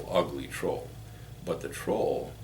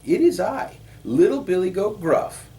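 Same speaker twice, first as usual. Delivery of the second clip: very high, loud little billy goat; squeaky-high small goat announcing himself; 13 semitones higher, 8 dB louder than usual. A narrator's reading. Little goat answering the troll, high small voice.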